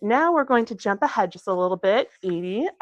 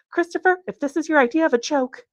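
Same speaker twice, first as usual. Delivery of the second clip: much higher, strained with fear; frightened plea; 11 semitones higher, 4 dB louder than usual. A narrator's reading. Kathleen, scared of the growl, pleads with Christopher, high frightened voice. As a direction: voice it like she's barely holding on. Terrified alone in dark forest.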